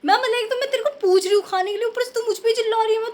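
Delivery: high-pitched, indignant retold scolding; worked-up anger. Telling viewers how she scolded Gunnu, exasperated. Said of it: "मेरे को इतनी गुस्सा आई है".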